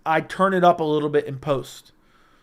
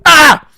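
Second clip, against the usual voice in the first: scream; 11 semitones higher, 14 dB louder than usual. Acted. Sudden scream into mic.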